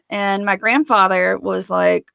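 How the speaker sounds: usual speaking voice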